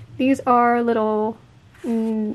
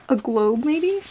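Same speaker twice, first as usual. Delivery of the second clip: ordinary talk, nothing marked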